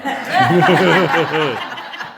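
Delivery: high, loud burst of laughter. Real, not acted. Retelling his friend's reply, loud laugh. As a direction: crack up at the punchline.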